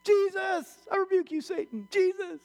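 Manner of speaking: very high mock-panicked cry; frantic rebuke. Man on the branch rebuking Satan, frantic high cry.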